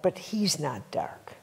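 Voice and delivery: soft slow whisper; whispered reassurance. Real, not acted. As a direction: hush it like a confidence. Tender clarification to audience.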